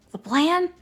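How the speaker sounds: high-pitched, uneasy echo; a touch of fear, voice rising; nervous gulp. A narrator's reading. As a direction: question it nervously, pitch jumping up. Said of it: "Grover gulped".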